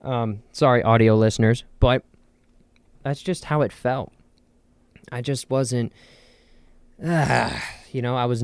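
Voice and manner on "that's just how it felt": ordinary delivery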